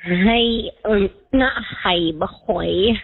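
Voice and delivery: normal talking voice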